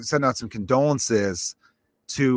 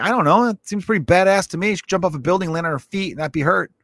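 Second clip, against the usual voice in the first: higher, admiring shrug; impressed; 6 semitones higher than usual. Retold or imitated. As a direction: sound won over by her powers. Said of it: "I'm like".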